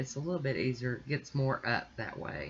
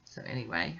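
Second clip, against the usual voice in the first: ordinary delivery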